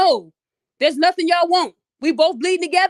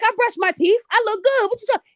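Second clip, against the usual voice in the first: indignant protest; 6 semitones higher than usual. Retold or imitated. Young hooper brushing off Charles's advice, indignant. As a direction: voice climbing higher, defensive and cocky. Retelling her cocky reply to Charles.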